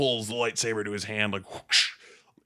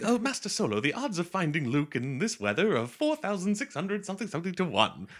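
lightly done prissy droid, higher; a hint of a fussy butler robot quoting odds; C-3PO impression; 9 semitones higher than usual. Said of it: "keeps telling people the fucking odds".

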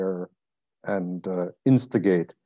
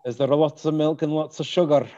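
higher, louder put-on Scottish; Scottish accent; 4 semitones higher, 5 dB louder than usual. Host quizzing guest about tea, Scottish accent. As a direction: ask it as a keen Scot. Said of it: "key to a good Scottish accent".

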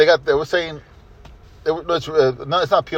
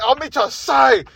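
higher, half-shouted heel threat; mock threat shout; 7 semitones higher than usual. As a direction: bark a hammed-up threat. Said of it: "He's like".

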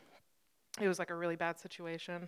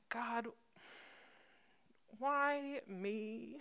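higher, softer, drawn-out plea; mock plaintive plea; 4 semitones higher, 7 dB quieter, much slower than usual. Crash victim asking God on the drive to hospital, mock plaintive. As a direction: imitate pleading with heaven.

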